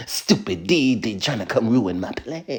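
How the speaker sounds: scheming cartoon villain voice, like an anime Dexter. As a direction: sneer it like a cartoon villain foiled by his rival. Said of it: "Just a simple little, er, villain voice".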